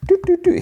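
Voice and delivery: very high sung-out keypad boops; keypad beeps. Retold or imitated. Man hits the code on the door, high mimicked beeps. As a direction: imitate electronic button beeps, high and even.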